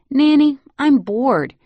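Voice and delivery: put-on high, loud, slow little-boy whine; bored child whine. Little boy whining to nanny he's bored. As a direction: drag it out like a bored toddler.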